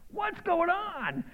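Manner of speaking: high, slow amazed cry; mock surprised. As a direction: act startled and stretch it out. Mock reaction of waking mouth.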